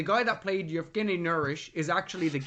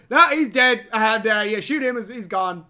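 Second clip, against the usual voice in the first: mock dismissive writers; 3 semitones higher, 9 dB louder than usual. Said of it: "the writers are like".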